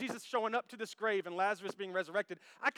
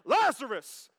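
loud, raised summons; Jesus calling out; 4 semitones higher, 8 dB louder, slower than usual. Acted. Preacher re-enacts Jesus at the tomb, shouting. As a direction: shout the name like a command. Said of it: "Jesus walks up".

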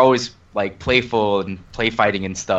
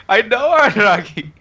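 laughing; 9 semitones higher, 4 dB louder than usual. Retold or imitated. Laughing, voicing his reaction.

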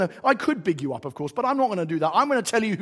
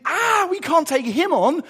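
high, drawn-out cry of mock alarm; scared animals; 9 semitones higher, 5 dB louder, slower than usual. Animals backing off from the Gruffalo, mock fright. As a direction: wail it, louder and slower. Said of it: "because they're scared of the Gruffalo".

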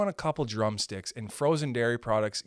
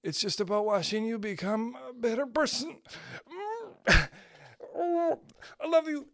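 mock tender; 7 semitones higher than usual. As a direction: coo it like a lovestruck softie. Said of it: "you're just like".

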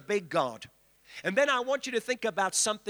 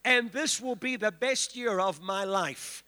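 leading a declaration; 3 semitones higher than usual. Shown as a demonstration. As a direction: lead the congregation's declaration. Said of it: "lift your hands and say".

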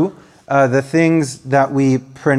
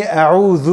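أعوذ as a model to repeat, one word alone; call and repeat; faster than usual. Shown as a demonstration. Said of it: "please repeat after me".

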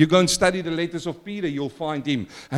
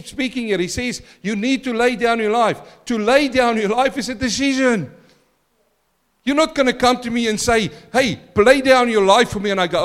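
higher, louder insistent preaching; building pulpit intensity; rising preaching; 7 semitones higher, 6 dB louder than usual. Acted. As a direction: press them to decide.

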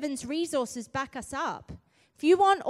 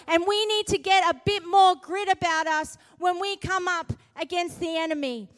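fiery preaching; 4 semitones higher, 7 dB louder than usual. Acted. Preacher urging the church against the enemy, loud and fierce. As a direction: roar it at the enemy.

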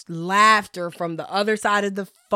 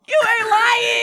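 much higher, laughing shout; shouted agreement; slower than usual. Real, not acted. Agreeing with her friend about the embarrassment, laughing shout. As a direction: yell your agreement.

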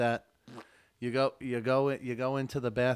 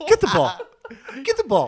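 loud, high-pitched fetch command; excited dog call; 13 semitones higher, 13 dB louder than usual. Pretend. Owner urging the dog to fetch, loud high call. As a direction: cheer on an eager dog.

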